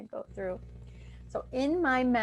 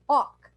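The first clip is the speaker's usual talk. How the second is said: loud "hawk" pushed from the back of the throat; demo hawk sound; 5 semitones higher, 8 dB louder than usual. Shown as a demonstration. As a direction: bark out a short "hawk" with an open throat. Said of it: "and then".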